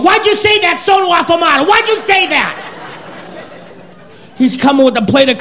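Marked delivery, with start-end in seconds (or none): 0.0-2.5 high, exasperated scolding; annoyed friends. Retold or imitated. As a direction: needle him for what he said. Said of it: "They're going".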